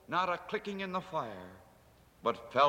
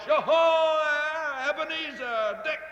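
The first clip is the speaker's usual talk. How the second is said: put-on much higher, loud, hearty old boss; a rich, jolly call from a big man; jovial Fezziwig; 14 semitones higher, 6 dB louder, slower than usual. Fezziwig calls his apprentices, hearty and jovial. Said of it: "comfortable, oily, rich, fat, jovial voice".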